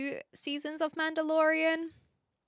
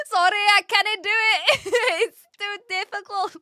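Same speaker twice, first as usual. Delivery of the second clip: high, loud Scots through laughter; giggling mock-Scottish protest; Scottish accent; 9 semitones higher, 8 dB louder than usual. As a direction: crack up mid Scottish accent. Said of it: "my best attempt at a Scottish accent".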